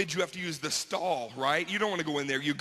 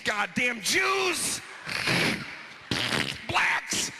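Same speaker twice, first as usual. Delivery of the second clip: mock angry bigot; 5 semitones higher, much slower than usual. Angry bathroom graffiti writer voiced.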